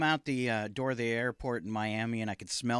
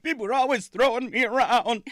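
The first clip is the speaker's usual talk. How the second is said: voice of the Frisbee; 10 semitones higher, 7 dB louder than usual. Pretend. Speaking as the Frisbee ashes.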